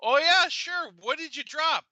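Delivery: very high, loud chirpy voice; overly cheerful. Retold or imitated. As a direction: play along too brightly. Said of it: "I was like".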